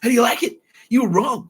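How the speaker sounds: high, loud crowing taunt; mock-triumphant jeer; gloating taunt. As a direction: crow over him, giddy.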